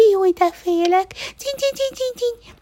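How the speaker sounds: very high panicky mouse squeaking; scared mouse squeaking; frightened squeak. A narrator's reading. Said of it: "cincogta az egérke".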